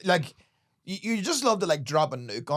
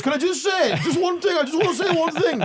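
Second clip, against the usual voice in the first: high, pushy heckler voice, fast at the end; 5 dB louder than usual. Retold or imitated. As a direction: mimic a drunk guy begging to speak. Heckler quoted pleading to speak.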